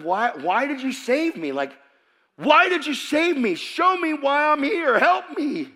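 high, loud desperate plea; freaking out. Retold or imitated. New believer pleading with Jesus in prayer, freaking out. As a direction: cry out as if begging for answers. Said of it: "I'm freaking out".

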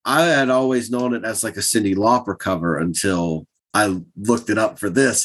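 usual speaking voice

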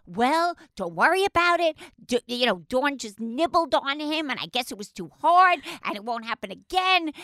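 1 exasperated retelling. Real, not acted. Mocking husband's confession.

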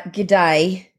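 Aussie greeting, "g'day" ending like "die"; Australian accent. Acted. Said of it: "any more of an Australian accent".

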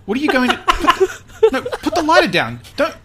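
high, urgent, stumbling protest; acted rising alarm; alarmed plea. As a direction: talk him down in a panic. Host sees guest holding a lighter.

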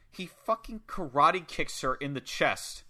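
ordinary talk

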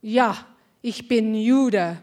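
slow, firm German declaration; squared-shoulders declaration. Retold or imitated. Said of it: "square my shoulders and I go".

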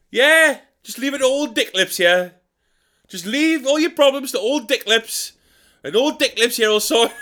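high, whiny put-upon grumble; martyred old man. Acted. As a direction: play the long-suffering martyr. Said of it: "I started calling myself".

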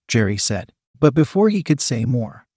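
ordinary delivery, nothing marked

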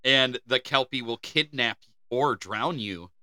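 ordinary talk

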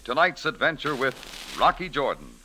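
ordinary talk, nothing marked

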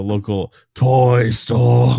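weirdly overemphasized "toy store."; odd line reading. Retold or imitated. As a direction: TOY STORE oddly drawn out. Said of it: "Why do you say it like that?".

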